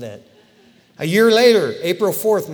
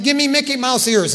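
mocking; 8 semitones higher, 7 dB louder than usual. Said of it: "it's like".

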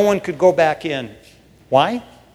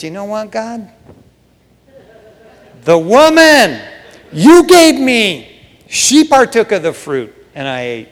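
Adam whining excuses, louder on the woman; mock Adam passing blame; 3 semitones higher, 5 dB louder than usual. Preacher voicing Adam blaming Eve to God, whiny excuse. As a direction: shift the blame like a caught kid.

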